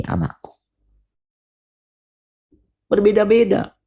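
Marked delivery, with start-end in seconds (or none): 0.0-0.6 put-on soft, intimate call to a child; tender call. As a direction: murmur it like a loving father. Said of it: "menangkap perkataan ini". (lembut seperti bapa memanggil anaknya)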